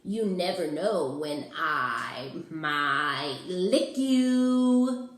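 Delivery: slow, looming monster voice building to a loud last word; mock terrifying monster. Mac tries to scare the child, slow and then loud. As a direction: draw it out to spook the kid, then pounce. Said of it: "trying to sound terrifying".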